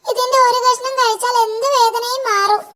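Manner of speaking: high little devil voice, offering; eager imp. Acted. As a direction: wheedle like a goblin. Imp offers magic root.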